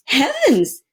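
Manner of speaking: mother startled by her son; surprised mother. A narrator's reading. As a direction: gasp it out in shock. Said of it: "said Missus Lambchop".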